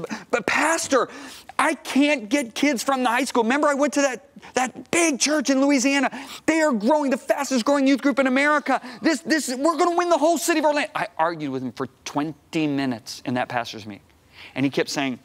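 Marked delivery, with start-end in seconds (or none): 0.0-10.9 pitched way up, voice straining with urgency; desperate pleading. Retold or imitated. Youth pastor pleads with senior pastor, high and desperate. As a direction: beg him, nearly breaking with frustration. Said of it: "I'm steaming mad".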